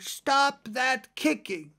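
a merman's lightly voiced order to stop; half-hearted bellow held out slowly; mock roar. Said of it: "he roared out".

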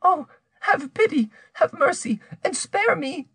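very high, imploring woman's voice; despairing plea. A narrator's reading. Rose begs her accuser to spare her, despairing. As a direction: beg for mercy, high and desperate. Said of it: "Rose cried in despair".

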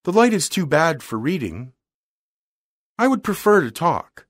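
lightly voiced refined young lady. A narrator's reading. Girl's voice held by narrator.

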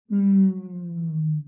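"M" as a long hummed mmm; letter sound. Shown as a demonstration. Teacher presenting the monito del monte's letter, long hummed "M". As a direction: hum each "M" loud at the start, then fading. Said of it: "a presentarnos una letra".

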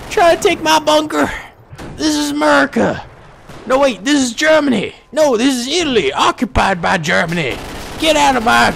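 high, hammed-up territorial rant; mock defiant shouting. Gunner to invaders of his bunker, hammed-up defiance.

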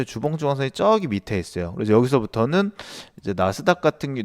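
normal talking voice

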